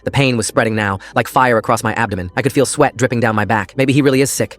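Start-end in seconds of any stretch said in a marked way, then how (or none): none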